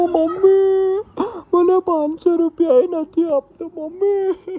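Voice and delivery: loud, high-pitched wail; crying. Real, not acted. Wife calling mother over withheld money, wailing in tears. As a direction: sob out a complaint to mum. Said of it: "કેમ રડે પણ તું?".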